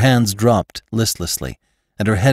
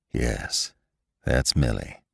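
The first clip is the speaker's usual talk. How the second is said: much softer, lower voice; softly; 8 semitones lower, 8 dB quieter, slower than usual. Acted. Lassiter confirms to Jane, soft and low. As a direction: say it gently, almost under breath. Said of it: "said Lassiter softly".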